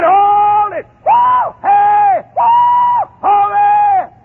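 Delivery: loud high shouts of whoa; calling out. Retold or imitated. Two men shouting from the hillside for the camp to stop. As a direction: holler to stop them from the hillside.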